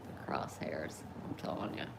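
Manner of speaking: whispered aside. Real, not acted. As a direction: breathe it out under your breath. Repeats joke under breath.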